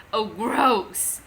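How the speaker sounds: chortling. A narrator's reading. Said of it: "he chortled".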